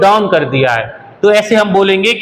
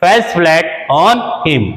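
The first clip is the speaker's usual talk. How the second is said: very slow, careful teacher read; very slow example for learners; very slow model sentence; much slower than usual. Shown as a demonstration. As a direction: dictate word by word. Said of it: "तो इस sentence को हम बोलेंगे".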